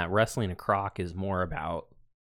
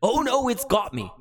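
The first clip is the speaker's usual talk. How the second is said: higher, hammed-up Australian cry of alarm; Australian croc hunter pretending the baby croc got him; mock panic accent; 9 semitones higher than usual. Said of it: "I don't know what that accent is".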